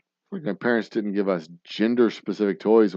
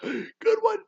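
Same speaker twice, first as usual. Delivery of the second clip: winded friend laughing. Retold or imitated. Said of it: "He's like".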